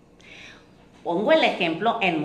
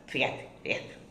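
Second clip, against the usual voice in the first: whispered, much softer, like sharing gossip; mock gossip whispered behind someone's back; gossipy whisper; 6 semitones lower, 8 dB quieter than usual. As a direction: lean in and whisper it. Imitating gossip aside.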